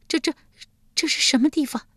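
high-pitched, stuttering fright; frightened stammer. A narrator's reading. Yulian waking in the hall of hell, frightened stammer. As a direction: stammer it out as a terrified woman. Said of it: "结结巴巴道".

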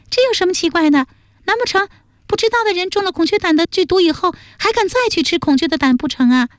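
put-on high, fast girlish retort; very high, put-on female voice; excitable young woman. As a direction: rattle off a scoffing comeback.

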